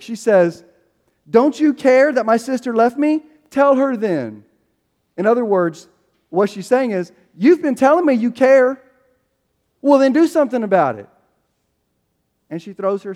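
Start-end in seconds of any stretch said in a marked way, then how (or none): 1.3-4.4 mildly sarcastic, higher and pushed; sarcastic retort. Retold or imitated. Martha confronting Jesus about her sister, mildly sarcastic. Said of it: "the way this reads in the original is sarcastic". 7.3-11.1 louder, higher mock demand; sarcastic challenge. Martha challenging Jesus to prove he cares, sarcastic and loud. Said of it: "the way this reads in the original is sarcastic".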